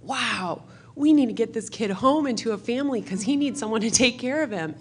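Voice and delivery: put-on high, loud, eager boy; a young boy urging the family to adopt, eager; eager kid. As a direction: burst out with a kid's eagerness. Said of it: "that was like".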